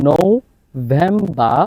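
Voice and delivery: নভেম্বর very slow with a clear V, no final R; slow November, V sound. Shown as a demonstration. Teacher drilling V sound in নভেম্বর. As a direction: stretch the V in নভেম্বর. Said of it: "ভি sound".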